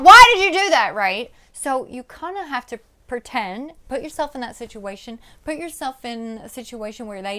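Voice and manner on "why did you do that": shrill scream, much higher and louder; the wrong way: yelling it out; screamed version. Shown as a demonstration. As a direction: let it blow up into a full scream. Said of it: "But it's not".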